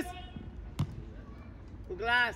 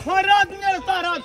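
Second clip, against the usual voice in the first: scolding shout; 7 semitones higher, 8 dB louder than usual. Real, not acted. Coach scolding tired player.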